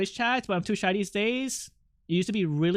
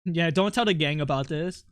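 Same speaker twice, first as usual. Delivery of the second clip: usual speaking voice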